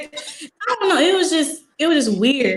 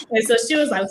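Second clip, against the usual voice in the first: usual speaking voice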